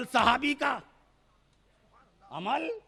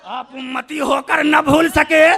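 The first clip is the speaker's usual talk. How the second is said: the same words again, higher and louder; loud sermon climax; preaching shout; 7 semitones higher, 17 dB louder than usual. Acted. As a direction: burst into a fervent cry. Repeated at full force.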